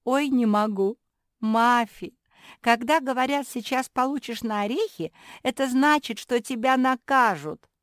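high, loud exclaiming character voice; giggly pug sister, amused. Acted. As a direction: explain loudly to a clueless sister.

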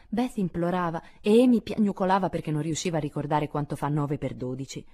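usual speaking voice

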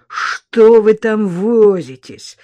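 loud, slow impatient demand; voiced, drawn-out hiss of impatience; angry hiss. A narrator's reading. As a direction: press them impatiently, stretching the words. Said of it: "прошипел парень".

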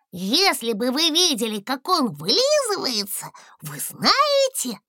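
high, slow and gleeful; a small dragon beaming about his papa; delighted little dragon. A narrator's reading. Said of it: "обрадовался дракончик".

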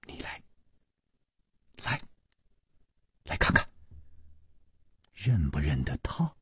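near-whispered invitation with pauses between phrases; hushed beckoning. A narrator's reading. Chu urging the frightened girl to look in the coffin, hushed. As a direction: whisper it, low and very soft.

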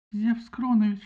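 high, loud plea; pleading. A narrator's reading. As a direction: beg with the name. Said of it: "взмолилась Фетида". (взмолилась громко и высоко)

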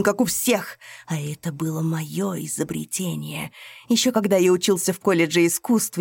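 loud, raging, rising to a scream; furious rant. A narrator's reading. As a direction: spit out the stolen years of rage. Ryzhov rages about stolen recipe.

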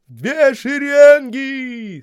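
high, slow, loud parade command; shouting drill sergeant. A narrator's reading. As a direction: drag it out from far away. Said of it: "снова долетел издалека голос". (протяжная строевая команда)

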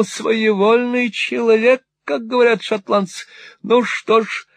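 put-on high, wheedling old miser voice; fawning old moneylender. Old usurer fawning on Ralph, high and wheedling. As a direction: simper it like a cringing usurer. Gride voiced in Nickleby reading.

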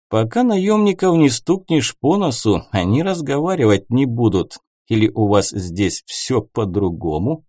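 ordinary delivery, nothing marked

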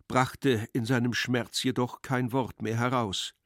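usual speaking voice

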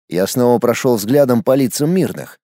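plain reading voice

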